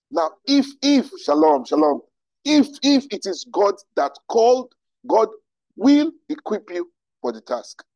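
high, loud, fervent preaching; emphatic, impassioned promise; passionate. Real, not acted. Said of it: "it's something I'm passionate about".

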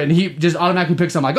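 ordinary talk

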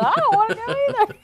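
high, fast and laughing; laughing reluctance. Retold or imitated. Agreeing with grandma about the house, laughing. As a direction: whine it through a laugh. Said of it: "I said".

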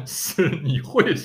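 laughing through the punchline. Real, not acted. Joke about ordering, laughter.

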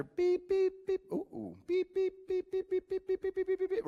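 high beeps that quicken into a rapid run; speeding-up detector beeps. Retold or imitated. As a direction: mimic a metal detector closing in on metal. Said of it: "it gets a little faster".

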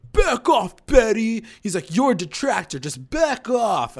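loud, high mock yell; loud angry back-off; angry snap. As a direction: bark it like a furious teen. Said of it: "And he's like".

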